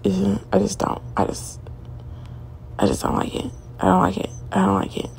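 fading to a whisper; trailing off. Real, not acted. Vlogger fades out, disliking the man, whispered. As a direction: let it die out under your breath.

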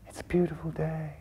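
soft, airy acted daydream; mock-blissful, quiet and spaced out; dreamy drifting away. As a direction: say it softly, as if floating off. Acting out someone drifting away.

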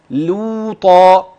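لوطا drawn out slowly, each sound held; teacher spells out لوطا slowly for the student to hear the thin lam; slow demo of the word. Shown as a demonstration. Teacher to student, showing the lam, slow careful recitation. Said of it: "اللام".

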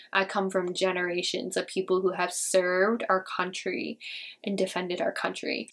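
normal talking voice

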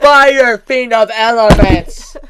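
slow, loud, high declaration; hammed-up heroic proclamation; mock dramatic shout. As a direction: bellow each word like an epic title. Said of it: "Even Kane's like".

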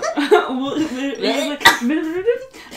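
long rising laugh; real laughter. Real, not acted.

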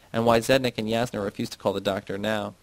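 plain reading voice

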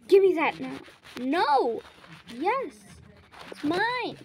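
loud sibling tug-of-war bickering; squabbling shouts. Acted. Siblings fighting over who owns it, loud bickering. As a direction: shout it, pitch climbing with each retort.

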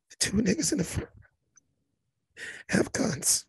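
quiet urgent warning on stage; hushed reply. Retold or imitated. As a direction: whisper a warning fast. Hushed reply while being hugged.

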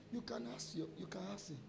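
softly dropped aside to listeners; quiet aside. Real, not acted. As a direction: drop your voice for a quick aside. Aside vouching for the retold story.

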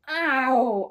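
sudden loud, drawn-out ow; loud cry of "ow". Real, not acted. Vlogger interrupting their own rant, loud ow. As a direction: yelp it out long and loud.

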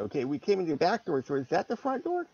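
idle wondering, pitch climbing to the question; musing aloud. Acted. Player showing how the character wonders about the door, musing. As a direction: think out loud, ending high. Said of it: "kind of musing it out loud".

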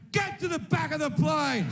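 high-pitched mock yell at a fellow passenger; triumphant yell.

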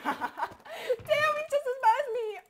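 startled laugh. Real, not acted.

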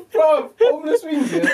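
high, giggly words through real laughter; can't hold it together, laughing; laughing while talking. Real, not acted. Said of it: "laughing at own line".

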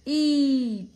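ई loud and held long; long-vowel demonstration. Shown as a demonstration. Teacher answers her sound question, long loud ई. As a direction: sustain ई loudly. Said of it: "क्या उच्चारण है इसका?".

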